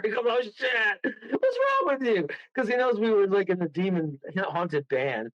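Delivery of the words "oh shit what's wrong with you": high-pitched, imitated dismay; retold exasperation. Owner scolding friend over a demonic guitar, retold exasperation. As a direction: act out an appalled scolding.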